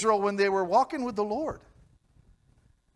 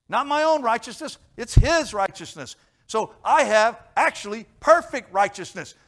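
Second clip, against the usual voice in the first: loud, high declaration of faith; a preacher shouting his identity in Christ; full-force preaching; 6 semitones higher, 8 dB louder than usual. Acted. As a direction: proclaim it to the rafters.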